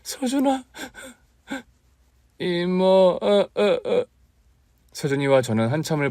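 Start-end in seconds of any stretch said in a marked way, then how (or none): 0.0-0.6 high, tender cry of his name; aunt calling to sobbing nephew; tearful call. Acted. Aunt calls crying nephew. 2.4-4.0 put-on high sobbing boy; child sobbing. Nephew sobbing to aunt.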